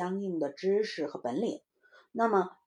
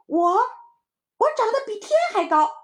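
put-on very high, loud, fast kid; one-upping little child; 8 dB louder than usual. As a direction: outdo your friend's boast, shrieking high. Jumping child outboasts playmate. (小孩抢着高声吹嘘)

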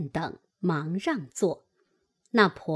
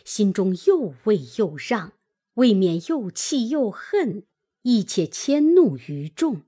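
ordinary delivery, nothing marked

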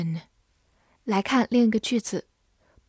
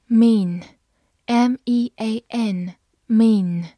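letters spelt out slowly and firmly; slow classroom spelling; 5 dB louder, much slower than usual. Shown as a demonstration. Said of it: "spelling a word for learners".

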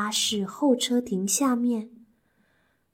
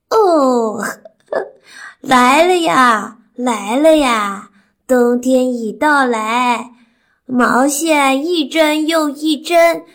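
slow, loud, chuckling granny voice; old granny; 4 semitones higher, 9 dB louder, slower than usual. Acted. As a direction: chant it like a cheerful old granny. Granny answers in rhyme.

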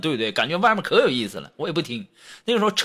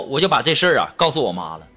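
normal talking voice

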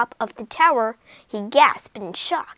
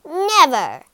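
cackling; 5 semitones higher, 9 dB louder than usual. Acted. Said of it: "she cackled".

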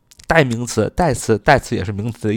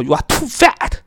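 mock rude remark; 11 semitones higher than usual. Shown as a demonstration. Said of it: "你绝对不能说".

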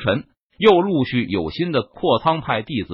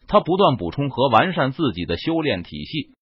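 ordinary delivery

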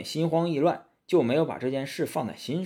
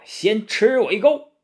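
higher, louder, slow shout; battle cry; 8 semitones higher, 6 dB louder than usual. A narrator's reading. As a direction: roar it as you strike. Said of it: "如意大仙喝道".

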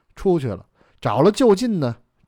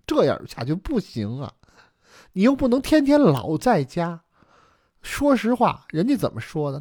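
pleading wife; 4 semitones higher than usual. Acted. Wife urging husband to talk to his brother, earnest complaint. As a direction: press the husband earnestly, pitch swooping up.

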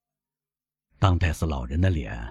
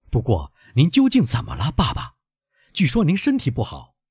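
put-on higher-pitched, lively young man; eager young son; 5 semitones higher than usual. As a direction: pour out plans to your father, then worry. Eager son talks to his father.

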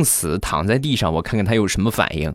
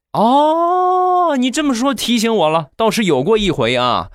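a sudden high "oh" from the mother, then settling; an old mother's lightly voiced sudden memory; mother remembering; 14 semitones higher than usual. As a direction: recall it with a high, bright start.

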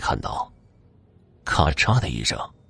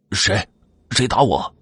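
mildly angry, startled demand. A narrator's reading. Boy just hit from behind demands who did it, angry. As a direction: demand it with a touch of temper. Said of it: "少飞怒气冲冲地问道".

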